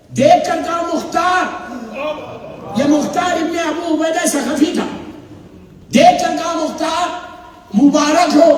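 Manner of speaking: fiery sermon storytelling at full force; impassioned majlis narration. Acted. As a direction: sweep the hall up in the drama, declaiming. Preaching the Karbala story.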